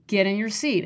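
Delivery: normal talking voice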